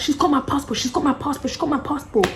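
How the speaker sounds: high, loud accusing chant; shrill accusation. Retold or imitated. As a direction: point and yell it repeatedly.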